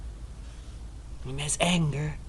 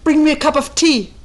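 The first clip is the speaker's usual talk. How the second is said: louder, higher, irritated order for tea; annoyed demand; 8 semitones higher, 9 dB louder than usual. Retold or imitated. Returning abbot demanding tea from the attendants, annoyed. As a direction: snap out the order like an impatient guest. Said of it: "More and more annoyed".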